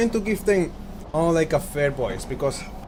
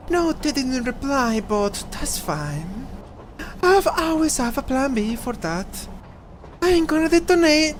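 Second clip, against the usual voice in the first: raised character voice; 8 semitones higher than usual. Acted. Voicing a game character.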